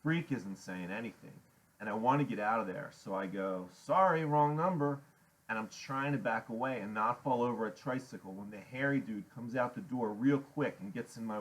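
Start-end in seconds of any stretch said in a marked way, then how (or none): 3.7-5.0 higher, louder hasty apology; nervous excuse. A narrator's reading. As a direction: excuse yourself and try to escape. Narrator backing away, wants out.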